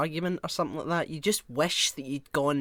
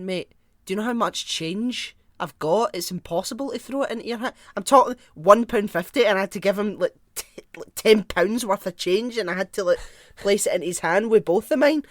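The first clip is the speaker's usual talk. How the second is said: louder, higher indignant rant; worked-up retelling, louder and higher; 5 semitones higher, 7 dB louder than usual. Retold or imitated. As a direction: sound outraged reliving it. Retelling the row, getting worked up.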